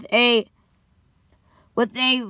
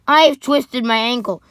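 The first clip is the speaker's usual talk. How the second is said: loud, sudden confession; blurted out; 6 dB louder than usual. A narrator's reading. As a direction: blurt out the injury. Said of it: "finally blurted out".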